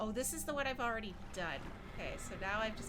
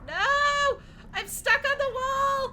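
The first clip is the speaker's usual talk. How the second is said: high, loud cries of panic; panicked shout; 9 dB louder, slower than usual. Real, not acted. Streamer stuck on a wall in game, panicked shouting. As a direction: yell as the game goes wrong.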